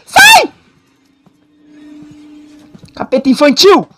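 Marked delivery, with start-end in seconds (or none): 0.0-0.5 high panicked yell; being chased. Real, not acted. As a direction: scream at the monster. Chased, yelling.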